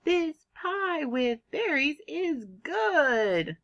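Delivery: high, drawn-out excited reading; excitement. Shown as a demonstration. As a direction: lead the class in a slow, excited read-aloud. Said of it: "read it with me with excitement".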